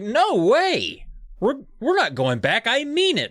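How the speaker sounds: high, insistent refusal; stubborn dad. Acted. Dad refusing guide loudly.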